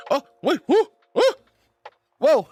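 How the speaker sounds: high, loud whoas; sudden alarmed exclaim; startled whoa. Real, not acted. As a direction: caught off guard. Startled by something on screen.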